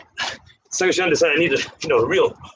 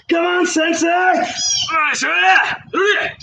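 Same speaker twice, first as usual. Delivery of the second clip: laughing shout; 12 semitones higher, much slower than usual. Real, not acted. Calling to sensei mid-workout.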